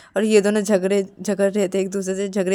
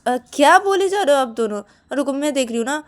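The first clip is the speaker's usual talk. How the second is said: raised, louder scolding; exasperated interruption; 6 semitones higher, 6 dB louder than usual. Acted. Stopping two people talking while checking, exasperated. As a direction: cut in on the two of them. Cutting into the argument.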